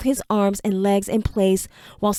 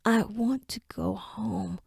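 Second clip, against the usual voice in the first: barely audible, slow whisper; faint whisper; 10 dB quieter, slower than usual. Acted. Enoch, voice failing, whispering his wish. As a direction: struggle to get the words out. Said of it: "just a faint whisper".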